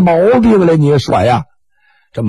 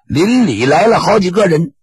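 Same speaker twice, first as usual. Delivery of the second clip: ordinary delivery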